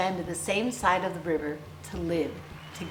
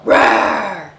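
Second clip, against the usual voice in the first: a big, much louder bear roar; growl; 13 dB louder than usual. A narrator's reading. That bear growling back at this bear, in a loud roar. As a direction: roar it full out like an angry bear. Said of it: "That bear growled".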